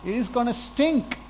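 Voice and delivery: loud and high objection; protesting Martha. Acted. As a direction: Martha protests, half laughing, pleading with the Lord. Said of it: "Then Martha said".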